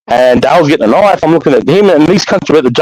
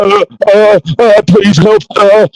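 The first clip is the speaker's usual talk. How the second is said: begging for help; 8 semitones higher, slower than usual. Retold or imitated. Girl begging for help, retold.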